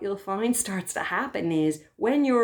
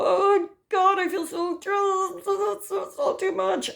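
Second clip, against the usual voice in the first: overwhelmed wail; 12 semitones higher, slower than usual. Retold or imitated. Overwhelmed self crying out to the inner carer, high and strained. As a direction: act swamped by too much feeling.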